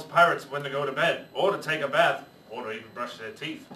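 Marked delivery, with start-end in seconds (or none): none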